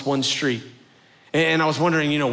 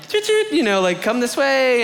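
high, sing-out call to a group; tour guide calling; 8 semitones higher than usual. Retold or imitated.

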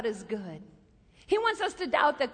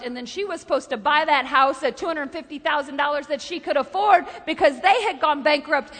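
usual speaking voice

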